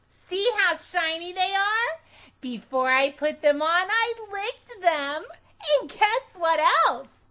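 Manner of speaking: smug and pleased with herself; Junie B voice. A narrator's reading. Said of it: "I said".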